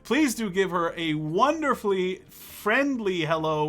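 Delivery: normal talking voice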